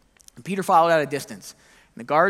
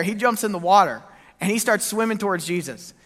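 normal talking voice

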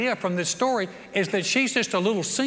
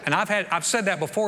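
usual speaking voice

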